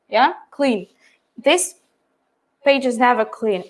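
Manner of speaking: usual speaking voice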